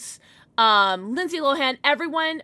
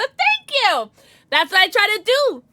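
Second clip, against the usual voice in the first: excited thanks; 8 semitones higher, 7 dB louder, slower than usual. Real, not acted. Thanking chat.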